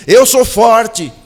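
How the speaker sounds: "eu sou forte" said very slowly and loud; bold declaration. Shown as a demonstration. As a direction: proclaim "eu sou forte" like a battle cry. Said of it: "falou assim".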